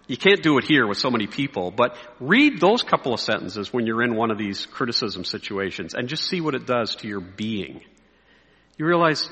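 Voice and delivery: ordinary delivery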